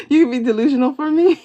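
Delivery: fast, high and loud teasing; giddy. Real, not acted. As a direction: rush it out, thrilled. Giddy reaction to the reading.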